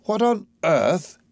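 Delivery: slow, incredulous exclamation; taken aback, stretching the words; astounded. A narrator's reading. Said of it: "Higgins is astounded".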